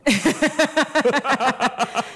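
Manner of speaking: bright burst of laughter; amused laugh at the doctor story. Real, not acted. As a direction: laugh out at the absurd answer.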